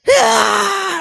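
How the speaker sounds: high, loud mock scream; short high shriek, imitated; retold scream. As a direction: shriek it in his face. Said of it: "screams in his face".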